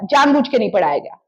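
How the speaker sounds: ordinary delivery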